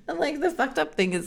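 ordinary talk, nothing marked

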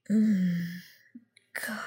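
usual speaking voice

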